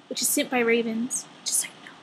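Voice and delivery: hushed, unvoiced aside; whispered aside. Real, not acted. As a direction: whisper it like a secret tip. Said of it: "whispered aside about band".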